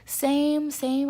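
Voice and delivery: high, loud agreement; eager agreement. Retold or imitated. Passenger agreeing with tired attendant, eager and loud. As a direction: re-enact an emphatic, high "me too".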